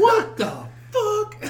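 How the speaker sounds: mock shocked shout, high and loud; shocked outburst. Imagining parents watching their kid, high shocked shout. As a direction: cry it out in hammed-up disbelief.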